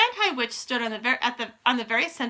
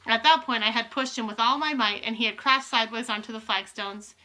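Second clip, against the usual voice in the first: ordinary delivery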